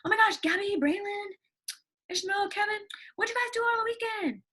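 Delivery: high, excited greeting to her class; excited teacher. Pretend. Teacher greets her students with delight, high and excited. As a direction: squeal the kids' names with delight. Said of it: "I'll be like".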